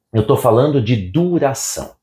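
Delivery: usual speaking voice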